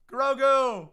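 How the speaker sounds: high, loud thrilled yell of the name; excited shout. Real, not acted. Streamer pulling a Grogu card, loud excited yell. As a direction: shout it like you just hit the chase card.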